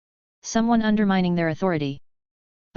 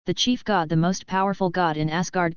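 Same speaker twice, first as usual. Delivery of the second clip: ordinary delivery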